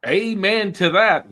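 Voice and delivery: loud, high and delighted; excited amen. Real, not acted. Host cheering the guest's spiritual answer, excited amen.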